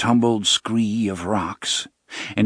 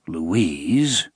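ordinary delivery

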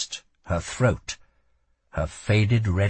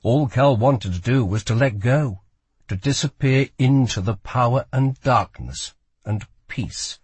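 usual speaking voice

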